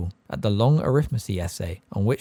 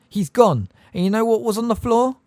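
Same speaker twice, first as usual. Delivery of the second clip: high, loud, furious yell; 12 semitones higher, 6 dB louder than usual. A narrator's reading. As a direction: shout the accusation. Ron yelling into the silence.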